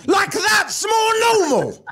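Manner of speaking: high, drawn-out sarcastic cry; mock incredulous. Real, not acted. Comic scoffing at the God explanation, mock incredulous. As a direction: pitch it way up and stretch it out in disbelief.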